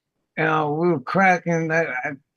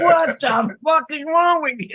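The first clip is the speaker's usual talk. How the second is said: outraged disbelief; 11 semitones higher than usual. Retold or imitated. Retelling his reaction to the mother's question, outraged disbelief. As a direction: throw up your hands at the absurdity. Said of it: "I'm like".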